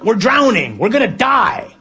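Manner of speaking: high, loud mock panic; exasperated disciples. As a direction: protest frantically over a sinking boat. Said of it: "And we're like".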